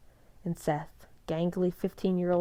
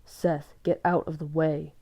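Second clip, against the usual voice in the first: hissed; 4 dB louder than usual. A narrator's reading. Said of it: "Edward hissed".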